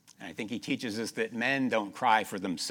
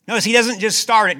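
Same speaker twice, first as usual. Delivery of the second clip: loud, ringing scripture reading; preaching at full force; 8 semitones higher, 11 dB louder than usual. Acted. Preacher reads a king's prayer aloud, loud and ringing. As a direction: proclaim the king's prayer to the congregation.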